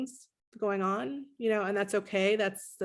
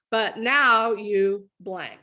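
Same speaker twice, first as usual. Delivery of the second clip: very slow and louder, hitting "now"; magic phrase, slowly; 6 dB louder, much slower than usual. Shown as a demonstration. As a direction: stretch "but now you blank", leaning hard on "now". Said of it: "This is the magic phrase".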